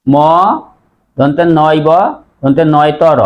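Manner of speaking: usual speaking voice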